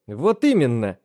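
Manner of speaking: plain reading voice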